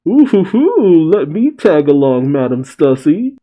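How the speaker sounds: high, loud lovestruck cooing; swooning Sanji. Acted. Sanji begs to tag along with Madam Stussy, lovestruck cooing.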